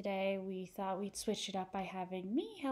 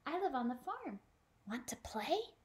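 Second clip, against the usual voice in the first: light, bouncy puppy voice; eager puppy voice; 4 semitones higher, 4 dB louder than usual. Acted. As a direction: sound like a playful puppy. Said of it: "yipped the puppy".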